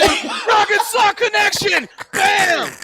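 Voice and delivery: high, slow shouted ring intro; announcer shout. Retold or imitated. Wrestlers storm the debate, shouted ring call.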